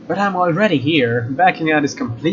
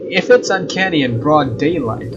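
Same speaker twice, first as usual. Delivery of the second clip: normal talking voice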